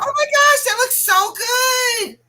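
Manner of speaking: real excited gush at a friend's craft; thrilled gushing. Real, not acted. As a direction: squeal over how good it looks. Friend admiring the finished book.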